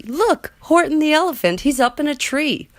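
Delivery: high, gleeful mocking shout; gleeful taunt. A narrator's reading. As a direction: crow it at him with glee. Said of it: "shouted with glee".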